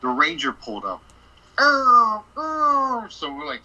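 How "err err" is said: high, loud imitated siren wail; siren noise. Imitating the ranger's siren pulling up, wailing.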